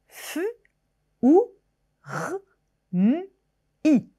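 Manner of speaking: "fu", "ou", "r", "m", "i" each given as a separate sound; sounds one by one. Shown as a demonstration. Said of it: "on peut percevoir chaque phonème".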